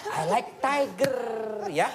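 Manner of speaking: put-on slow, drawn-out prompt for a child to copy; dad feeding his daughter the answer, slowly. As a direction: spell the answer out slowly for a kid. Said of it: "ya sekarang direkam ya".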